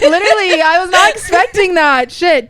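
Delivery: laughing through sudden tears; getting emotional. Real, not acted. Host thanking her mentor, tearing up and laughing. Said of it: "I'm getting emotional right now".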